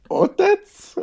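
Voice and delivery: very high, incredulous "Otec?"; young clerk baffled by the request; puzzled clerk. Retold or imitated. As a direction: echo it back in disbelief. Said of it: "She looks at me like".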